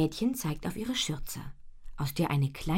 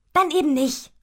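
high, loud, cheeky retort; saucy storybook brush-off; 12 semitones higher, 11 dB louder than usual. A narrator's reading. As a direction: toss it back cheekily, voice up. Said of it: "sagt sie frech".

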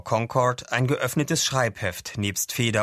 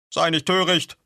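loud, higher-pitched rebuke; snappish; 8 semitones higher, 5 dB louder than usual. Acted. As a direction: cut him off with a sharp scolding. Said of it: "schnappte Katzenkissen".

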